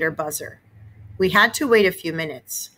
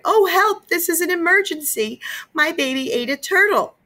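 much higher, frantic plea; panicked cry; 12 semitones higher than usual. A narrator's reading. Mother crying to the operator in panic, very high. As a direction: beg the operator for help.

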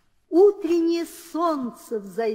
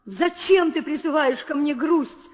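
ordinary delivery

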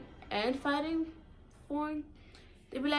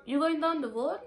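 put-on Virgin Islands accent; 2 semitones higher than usual. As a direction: call to a friend heading down the road, island style. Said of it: "We be like".

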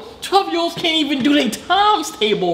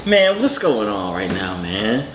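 pitched-down voice; 4 dB quieter than usual. Real, not acted. Reactor begging the storyteller to explain, voice pitched far down. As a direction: drop way down and plead.

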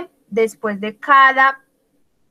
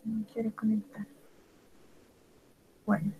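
whisper; 15 dB quieter, much faster than usual. Real, not acted. Student whispering off mic.

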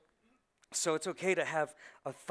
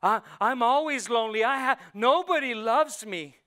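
loud whining self-pity, higher; whiny lament; 4 semitones higher, 10 dB louder than usual. Retold or imitated. A man sulking that nobody loves him, whiny and loud. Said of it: "As a man be".

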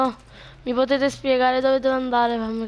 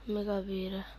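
normal talking voice

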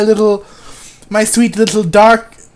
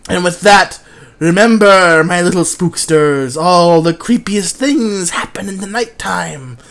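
lower, hushed horror-host farewell; lightly voiced campfire-host closing; spooky host sign-off; 6 semitones lower than usual. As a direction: send the spooksters off with a creepy hush.

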